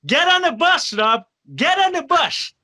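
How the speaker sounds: high, loud yelling at Rob to get on the bus; frantic shout. Retold or imitated. Pat Patterson yelling at Rob to board the bus, high frantic shout. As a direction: holler it like a panicked handler herding a star. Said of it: "Pat Patterson's like".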